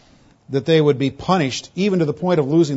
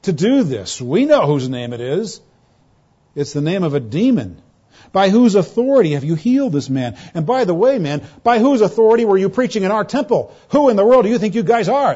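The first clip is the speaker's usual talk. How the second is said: building from sneering to a loud, high, indignant demand; a council mocking, then shouting down two upstarts; scornful accusation; 5 semitones higher, 4 dB louder than usual. Preacher acting the Sanhedrin's attack on Peter and John, rising to an angry shout. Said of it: "You hear this same cynical attitude".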